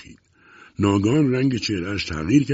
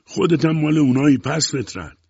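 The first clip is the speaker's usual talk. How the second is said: high, loud insult; angry shout; 7 semitones higher, 5 dB louder than usual. A narrator's reading. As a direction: hurl it out loudly.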